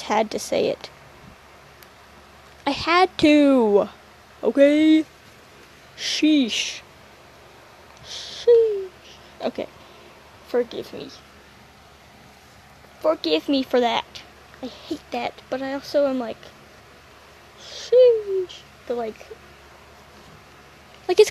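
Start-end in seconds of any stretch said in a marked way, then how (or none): 2.7-6.8 slow, insistent I had to; playful defiance, drawn out; mock defiant. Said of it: "fired up about that". 8.0-8.9 sheesh. Pretend. Exclaiming in mock exasperation. 17.6-18.6 sheesh. Pretend. Exclaiming sheesh.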